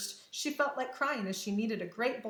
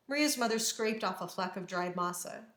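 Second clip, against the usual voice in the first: usual speaking voice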